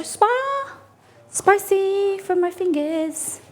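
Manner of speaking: high, slow squeal; high pained squeak. Real, not acted. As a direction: squeal it out slowly. Burning fingers on popovers.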